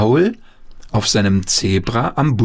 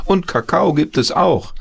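calling out; 8 semitones higher than usual. A narrator's reading. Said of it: "rief die Oma ins Bad".

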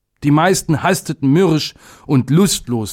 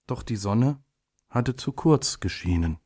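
plain reading voice

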